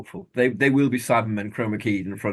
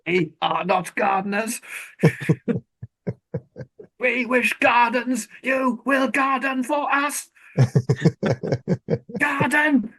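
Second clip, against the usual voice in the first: Dalek voice; 13 semitones higher, much slower than usual. Acted. Dalek voice in comic riff.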